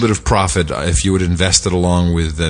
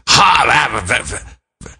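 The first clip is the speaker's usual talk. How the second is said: put-on heavy Russian accent, high and halting; 6 semitones higher than usual. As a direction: imitate an older Russian assistant. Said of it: "she really has a real heavy Russian accent".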